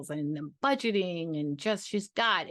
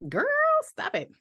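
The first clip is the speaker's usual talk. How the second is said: much higher flattered squeal; 4 dB louder than usual. Real, not acted. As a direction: squeal with delight.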